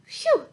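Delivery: high, breathy exhale of relief; tired phew. Acted. Panda pooped from playing with its pals, high tired phew. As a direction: blow it out like you're worn out.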